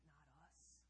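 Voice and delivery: usual speaking voice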